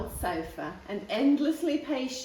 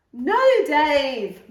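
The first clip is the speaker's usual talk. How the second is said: mum calls out, louder; calling a little louder; 5 semitones higher, 10 dB louder than usual. A narrator's reading. Mum calls the dog from across the room, louder. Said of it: "Mum called a little louder".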